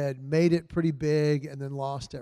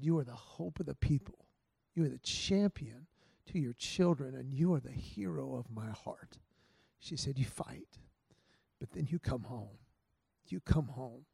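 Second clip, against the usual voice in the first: tender encouragement; 2 semitones lower, 7 dB quieter than usual. Retold or imitated. Wife's tender speech before fight.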